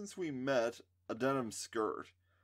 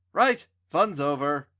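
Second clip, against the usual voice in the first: loud; 4 semitones higher, 11 dB louder than usual. A narrator's reading. Alex ends the prank.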